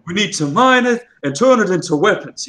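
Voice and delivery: Jon Snow style northern English, vowels drawn up high; put-on northern English accent; imitated northern English accent. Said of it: "every time he says it. He just".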